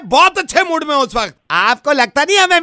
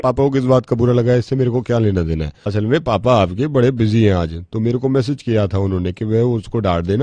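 much lower, gruff prank-caller voice; deep put-on voice. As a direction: play a deep-voiced grown man scolding a kid.

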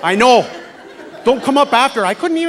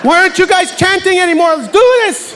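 rallying shout; 7 semitones higher than usual. Retold or imitated. Rallying a crowd that stopped.

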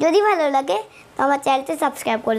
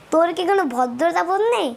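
fast recap; much faster than usual. Shown as a demonstration. Quick recap of Bengali.

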